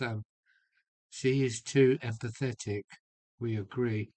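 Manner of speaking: normal talking voice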